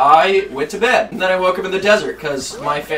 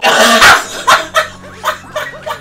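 squeaky breathless laughter; high giggling fit; 5 dB louder than usual. Real, not acted. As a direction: burst into giggles. Cracking up mid-story.

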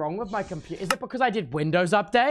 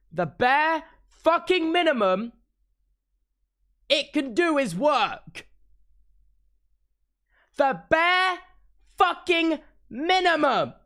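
furious complaint about paid tech; angry rant; 7 semitones higher, slower than usual. Real, not acted. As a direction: spell out the outrage word by word. Ranting about expensive tech failing.

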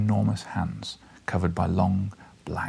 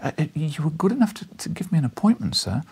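put-on higher, hesitant, deferential young man; humbly; 8 semitones higher than usual. A narrator's reading. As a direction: stammer it out humbly. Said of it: "I said humbly".

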